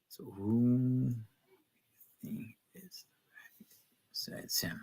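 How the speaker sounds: unvoiced hushed whisper. Real, not acted. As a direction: drop to a secret whisper. Hushed aside reading chat.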